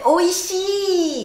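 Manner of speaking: oishi lifted with real feeling; mean it as you say oishi; heartfelt oishi. Shown as a demonstration. Teacher asking for feeling in oishi, heartfelt. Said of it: "Avec du sentiment, s'il vous plaît".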